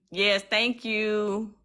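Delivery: normal talking voice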